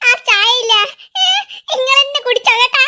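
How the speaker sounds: sulky shout back at aunt; huffy shout. Acted. Boy retorts to aunt. (പിണങ്ങി ഉറക്കെ പറയുന്നു)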